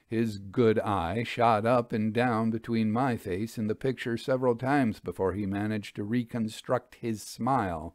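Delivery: usual speaking voice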